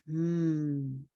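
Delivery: long musing "hmm"; pondering hum. Pretend. Listeners integrating before they can know, pondering hum. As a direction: hum it slowly, as if absorbing. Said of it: "you're just all like".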